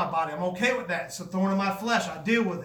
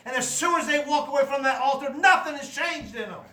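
louder, higher preaching push; preaching at full force; 3 semitones higher, 4 dB louder than usual. Acted. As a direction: drive it home to the congregation.

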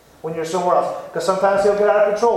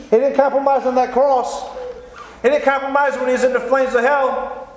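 raised, high-pitched preacher's cry; fiery preaching; 5 semitones higher than usual. Acted. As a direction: preach it hard to the congregation. Preaching at full force.

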